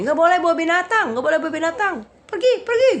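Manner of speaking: high, loud mock scolding; hammed-up high-pitched shouted order; imitated angry man. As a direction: bellow a ban as if chasing someone off. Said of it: "dia bilang gini".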